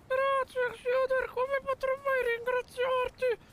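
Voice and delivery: very high falsetto; grateful little falsetto character; squeaky cartoon voice. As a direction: gush thanks like a tiny rescued creature. Squeaky character thanks rescuer.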